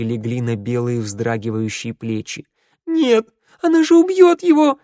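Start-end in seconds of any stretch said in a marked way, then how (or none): 2.9-4.8 high, loud terrified cry; panicked scream. A narrator's reading. Girl screams as shadow grabs him.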